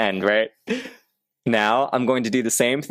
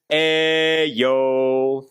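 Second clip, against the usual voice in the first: open-throat drill; 3 semitones higher than usual. Shown as a demonstration. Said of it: "mindful of opening up your throat".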